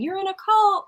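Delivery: imitated screaming. Critic yelling at a Scientologist, mock screaming. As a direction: act out a heckler's high-pitched cry. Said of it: "like screaming".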